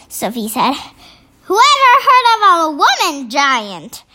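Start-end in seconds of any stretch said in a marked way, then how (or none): 1.5-4.0 giant's shout. A narrator's reading. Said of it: "shouted the B F G".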